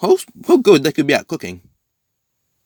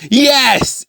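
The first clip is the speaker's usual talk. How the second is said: gleeful yes; 13 semitones higher, 6 dB louder than usual. Acted. Deku's symbiote gleefully takes over.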